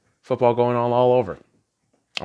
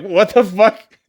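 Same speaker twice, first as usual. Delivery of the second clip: mock outrage; 9 semitones higher, 6 dB louder, slower than usual. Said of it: "It's like".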